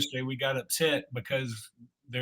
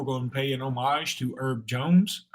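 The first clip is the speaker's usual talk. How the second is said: normal talking voice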